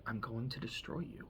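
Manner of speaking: quiet threat. Retold or imitated. Dude threatens Earn, quietly.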